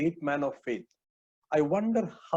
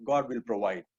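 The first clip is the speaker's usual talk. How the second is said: gentle deep voice; 7 semitones lower than usual. Retold or imitated. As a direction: reassure the boy, gently and low. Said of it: "I'm sure in very gentle, deep voice says".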